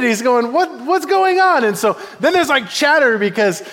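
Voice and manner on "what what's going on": high, agitated mock protest; offended. Offended David demanding answers from the soldiers, high indignant protest. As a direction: play up the indignation, pitched high. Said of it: "He's offended".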